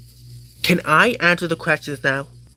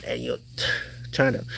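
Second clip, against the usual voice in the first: normal talking voice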